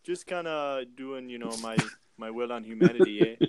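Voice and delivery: light mock Canadian accent with eh; lightly hammed-up Canadian, polite and apologetic. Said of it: "the Canadian stabber".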